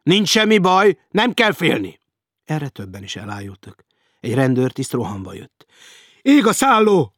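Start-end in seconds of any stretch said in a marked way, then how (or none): 0.0-2.0 loud, high shouted reassurance; captain bellowing over a panic; booming shout. A narrator's reading. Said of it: "harsogta a kapitány". 6.2-7.1 loud, high cry of fire; urgent warning yelled through a hotel; alarmed shout. A narrator's reading.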